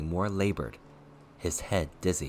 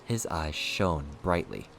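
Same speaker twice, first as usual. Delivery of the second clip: usual speaking voice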